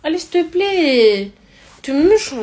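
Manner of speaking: put-on high, drawn-out childish plea; beg, then pout when refused; whiny begging. Girl begging Steve for his cereal bar, cutesy whine.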